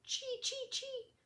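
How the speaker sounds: very high, soft chittering; high squeaky chee chee; squirrel chatter. A narrator's reading. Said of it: "A gray squirrel chattered".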